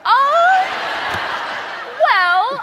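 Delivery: loud, high laughing outburst; laughing shout. Real, not acted. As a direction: burst out laughing at the question. Laughing shout at living home.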